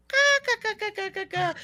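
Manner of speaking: imitating a grandmother's cackling laugh; grandma's cackle. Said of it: "she lets out her cackle of a laugh".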